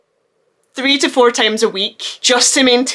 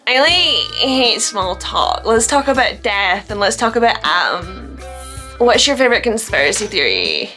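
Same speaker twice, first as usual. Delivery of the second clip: mock the quirky deep girl; pretentious deep talker. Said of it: "I'm not trying to be like".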